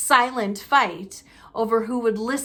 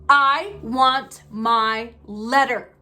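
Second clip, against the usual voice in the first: slow, loud insistent shout; 5 dB louder, much slower than usual. Acted. Boy shouting at his uncle for his letter, slow and loud. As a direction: hammer out each word in anger. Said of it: "he shouted".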